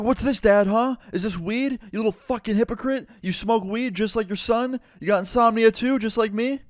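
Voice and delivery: high-pitched mocking tirade; angry accusation. Son accusing his dad of hypocrisy over weed, heated. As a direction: shove the evidence in his face.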